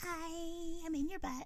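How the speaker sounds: high, soft little creature greeting; tiny worm voice. Pretend. Worm greeting its host, tiny voice.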